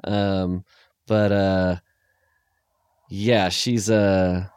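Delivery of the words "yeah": ordinary talk, nothing marked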